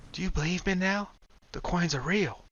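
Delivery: put-on a pleading young woman. Kai, higher female voice.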